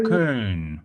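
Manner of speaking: Köln with a long rounded ö, falling tune; German ö demo. Shown as a demonstration. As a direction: model Köln, holding the German ö. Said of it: "managed to get that ö sound".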